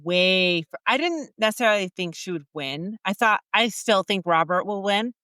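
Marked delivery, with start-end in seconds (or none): none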